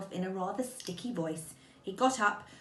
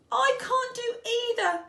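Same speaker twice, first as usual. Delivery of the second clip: high, louder wail of dismay; distressed; 5 dB louder than usual. A narrator's reading. Pooh unable to go back or on, distressed. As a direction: pitch it high, getting desperate. Pooh stuck, cannot move either way.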